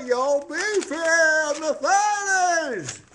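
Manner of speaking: high, slow put-on yo greeting; mock street greeting. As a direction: drawl it like a cool kid.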